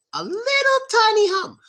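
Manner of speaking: very high-pitched, playful tiny voice; squeaky tiny voice. Shown as a demonstration. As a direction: shoot way up high to show how tiny. Teacher hams up how tiny it is.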